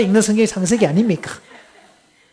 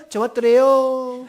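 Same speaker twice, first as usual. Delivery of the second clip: high, sing-song Gangwon voice, drawn out at the end; Gangwon dialect; 4 semitones higher, 4 dB louder, slower than usual. Acted. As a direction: imitate a Gangwon local, pitched way up. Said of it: "강원도 쪽은 수식어가 없네요". (높고 늘어지는 강원도 사투리 흉내)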